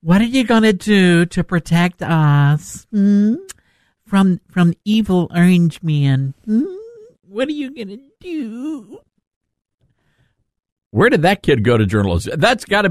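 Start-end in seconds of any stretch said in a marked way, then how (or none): 0.0-9.0 slow, put-on higher voice, pleading; mocking the reporter. Host mocks reporter asking for protection from Trump, simpering imitation. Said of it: "can we talk about the reporter".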